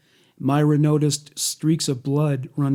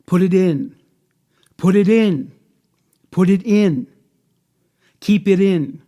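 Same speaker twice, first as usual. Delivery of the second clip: higher, louder repeated orders; forceful command; 5 semitones higher, 7 dB louder than usual. A narrator's reading. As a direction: read it as an insistent, angry demand. Reads captor's forceful repeated orders.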